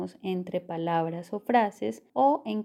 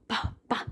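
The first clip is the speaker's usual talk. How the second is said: breathy unvoiced "pa, pa" bursts of air; popping plosives; 4 dB quieter than usual. Shown as a demonstration. Teacher imitating a mic too close, breathy puffed plosives. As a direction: puff the p's like mic popping. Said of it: "como con mucho aire".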